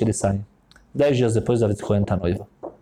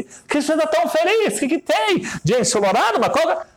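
taxi driver voice. Retold or imitated. Taxi driver cheerfully greeting his bride passenger, high voice. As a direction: play the chatty Israeli taxi driver, pitched way up.

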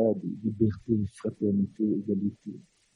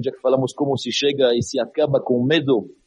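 ordinary talk, nothing marked

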